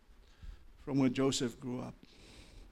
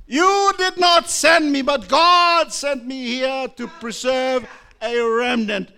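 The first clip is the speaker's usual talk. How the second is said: much higher and louder, slow and proclaimed; Joseph's triumphant forgiveness; 12 semitones higher, 14 dB louder, slower than usual. Acted. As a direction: declare God's purpose to the brothers. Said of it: "You know what he said?".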